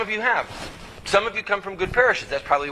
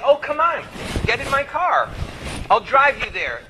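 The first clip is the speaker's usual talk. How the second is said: mock eager welcome; 8 semitones higher than usual. Voter eagerly offering the pro-choice candidate a lift, mock eager. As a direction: play the cheerful getaway driver.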